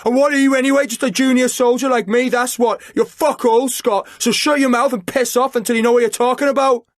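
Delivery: furious rant. A narrator's reading. Chris ranting at Liam in his face, furious yelling. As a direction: keep shouting him down. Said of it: "he yelled".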